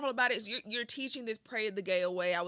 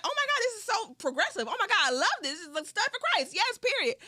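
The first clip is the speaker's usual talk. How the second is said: high, thrilled fangirl gush; gushing excitement; 10 semitones higher than usual. Retold or imitated. Retelling first seeing her video, high gushing praise. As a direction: squeal with delight. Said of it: "I was like".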